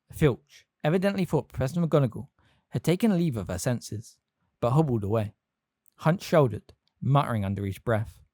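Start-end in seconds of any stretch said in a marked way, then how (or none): none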